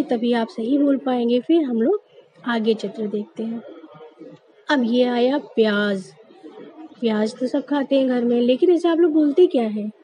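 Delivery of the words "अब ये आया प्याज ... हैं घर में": ordinary delivery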